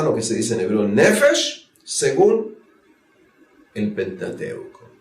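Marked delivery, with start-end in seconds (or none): none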